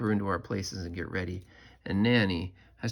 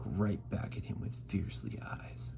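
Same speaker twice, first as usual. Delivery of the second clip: whispering; 8 dB quieter than usual. Acted. Narrating a staring contest.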